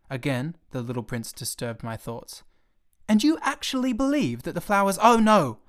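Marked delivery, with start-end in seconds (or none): 3.1-5.0 put-on indignant child. Prince presses the pilot angrily. 5.0-5.6 loud, higher exasperated cry; cried out. Acted. Pilot losing patience with the little prince, crying out loud. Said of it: "I cried".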